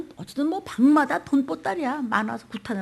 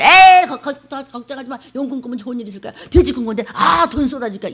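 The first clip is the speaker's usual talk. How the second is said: put-on careless drunk pastor; 9 dB louder than usual. Drunk pastor babbling reassurance.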